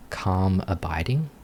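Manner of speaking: ordinary talk, nothing marked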